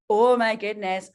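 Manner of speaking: stretched-out, amused astonishment; retold astonishment. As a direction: draw it out in mock amazement. Retold astonished realisation about Sweden.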